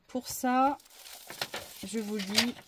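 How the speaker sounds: normal talking voice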